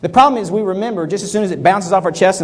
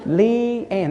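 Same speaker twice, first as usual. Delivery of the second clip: repeating Leanne softer each time; repeating the name; 7 dB quieter, much slower than usual. Shown as a demonstration. Speaker showing the class how to repeat a name, fading. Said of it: "repeat the name naturally throughout".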